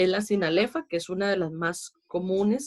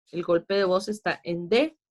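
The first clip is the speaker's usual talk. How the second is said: normal talking voice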